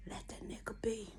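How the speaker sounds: breathy whispered aside. Real, not acted. Whispering advice to young ladies about a bad man. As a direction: drop to a whisper to let it sink in.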